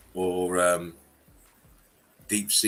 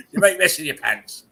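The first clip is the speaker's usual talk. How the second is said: hammed-up teasing line, louder; louder mock repeat; 7 semitones higher, 5 dB louder than usual. Teasing friend, louder repeat of the joke line.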